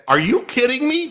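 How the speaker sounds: high, loud incredulous outburst; blurted disbelief. Retold or imitated. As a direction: blurt it out, half laughing in disbelief. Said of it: "It, it just came out of my mouth".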